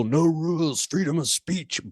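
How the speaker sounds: sneering high mock of a slogan; mocking quote. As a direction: scoff at the slogan in a high put-on voice. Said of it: "bullshit is".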